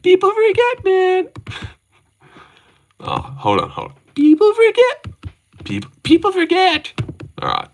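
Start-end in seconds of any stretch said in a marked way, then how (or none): 0.0-1.3 very high-pitched squeaky catchphrase impression; imitating someone in a high, put-on voice; Armin Saruki. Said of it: "Armin Saruki". 4.1-6.9 very high falsetto imitation, a bit louder; squeaky put-on voice, retrying the line; catchphrase impression. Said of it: "I've been trying to ... improve that".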